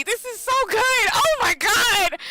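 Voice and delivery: high-pitched gushing outburst; excited hollering. Real, not acted. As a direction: holler it, overwhelmed by how good it is. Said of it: "why I'm screaming and hollering right now".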